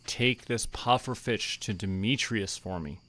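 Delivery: usual speaking voice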